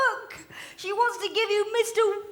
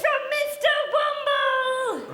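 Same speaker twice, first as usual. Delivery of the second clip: singsong toy voice; 10 semitones higher, 3 dB louder, slower than usual. Pretend. Toy kiss for a child.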